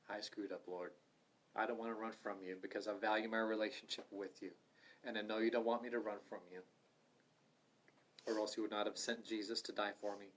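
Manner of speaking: hushed, humble prayer; a quiet confession spoken to the Lord; soft prayer. Pretend. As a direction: pray it softly and sincerely.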